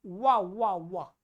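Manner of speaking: व as 'wa', lips not touching; clear V sound. Shown as a demonstration. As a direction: round the lips forward for each व. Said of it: "ब नहीं है". (व, होंठ मिलाए बिना)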